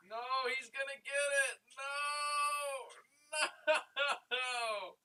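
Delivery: very loud, high wail with laughter; screaming laugh-cry. Real, not acted. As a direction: howl in mock despair. Robbed in game, laugh-cry.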